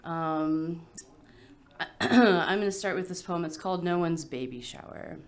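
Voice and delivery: ordinary talk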